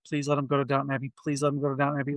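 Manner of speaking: quick, low, soft plea to oneself; hushed pleading. Retold or imitated. Moviegoer silently begging that the old crowd picks another film, hushed pleading. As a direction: plead fast under your breath.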